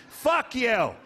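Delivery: usual speaking voice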